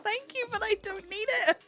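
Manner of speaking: put-on high, gentle kid-talk; sweet talk to a toddler. Narrator turning down the toddler's hat. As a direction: thank the little one kindly. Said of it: "I was like".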